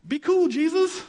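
high, strained plea to Jesus; mock protest. Real, not acted. Pastor mock-pleading with Jesus over a hard verse, high strained plea.

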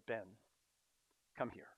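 put-on soft, gentle call to a small child; soft invitation. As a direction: kneel and coax him in softly. Kneeling, arms open to son.

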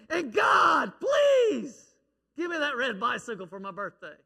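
put-on boy screaming again. Little boy yelling his birthday wish at God again. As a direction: scream the prayer even harder. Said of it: "he said it again".

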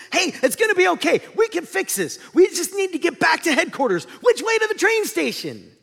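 high, loud, relentlessly upbeat cartoon voice; Joy. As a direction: gloss over trouble with high-pitched cheer. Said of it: "trying to kind of wash over things".

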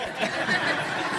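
breathy, voiceless chuckle; soft self-amused laughter. Real, not acted. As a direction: laugh quietly at your own joke. Laughing at his own accent.

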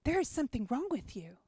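lightly voiced whispering enemy; hinted whisper. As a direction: murmur the lie like a tempter at her ear. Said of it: "he whispered in my ear".

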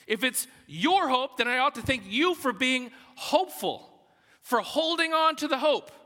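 high, loud, emphatic pulpit voice; forceful preaching. Acted. Preacher arguing that Paul thanks God for faith, forceful. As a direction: preach it hard, leaning on the contrasts.

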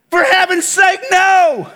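indignant preacher's high "no"; emphatic exclamation. Real, not acted. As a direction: cry it out as an obvious answer. Preacher emphatically answers his rhetorical question.

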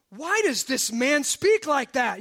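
slow, emphatic indignant challenge; indignant questioning. Acted. Scribes objecting to Jesus forgiving, loudly indignant. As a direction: demand it, stretching the words in disbelief.

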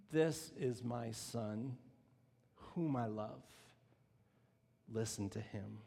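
low, slow, soft voice from the cloud; God speaking to the disciples, low and slow. Acted.

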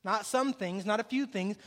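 ordinary talk, nothing marked

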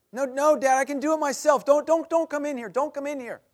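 high, loud panicked pleading; a frightened kid begging dad to stay out; panicked boy. Retold or imitated. Panicked son refusing father.

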